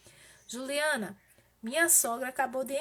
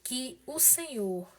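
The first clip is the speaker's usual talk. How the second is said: subdued, measured prayer with pauses; intercessory prayer; 2 semitones lower, 3 dB quieter than usual. Real, not acted. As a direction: plead gently with God for someone ill. (oração de intercessão, serena e contida)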